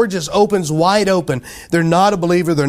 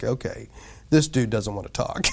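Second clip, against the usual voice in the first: low, softer inner thought; retold quiet realization; subdued aside; 5 semitones lower, 8 dB quieter than usual. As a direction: quietly back off, as if to yourself. Retold inner reaction to snub.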